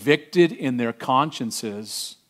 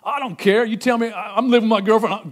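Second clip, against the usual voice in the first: raised, flippant mock voice of a defiant churchgoer; hammed-up dismissive sinner; mocking a careless sinner; 7 semitones higher, 4 dB louder than usual. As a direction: sneer it like someone who shrugs off God. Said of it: "someone that doesn't care what God".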